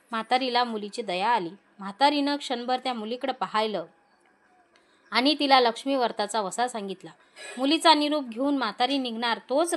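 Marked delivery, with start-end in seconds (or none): none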